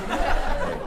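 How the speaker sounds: normal talking voice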